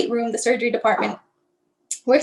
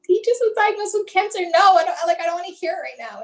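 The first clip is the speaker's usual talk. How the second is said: higher-pitched retold objection; upset protest; 6 semitones higher than usual. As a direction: push back in a raised, distressed pitch. Acted upset protest to the nurse.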